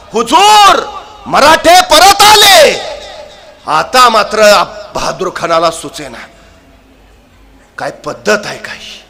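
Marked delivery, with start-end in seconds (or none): 0.1-2.8 loud, higher, slow alarmed shout; spy shouting. Acted. Spy at the rear shouts to Bahadur Khan, alarmed shout. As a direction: shout the warning across the field. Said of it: "हेर परत ओरडला".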